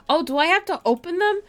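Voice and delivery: ordinary talk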